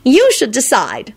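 put-on high, slow girlish delight; happy. A narrator's reading. Woman hands her partner the choice, happy. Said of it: "Solange told him happily".

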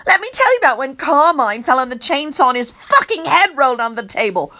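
ordinary delivery, nothing marked

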